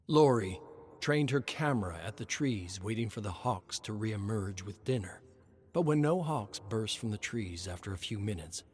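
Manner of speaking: plain reading voice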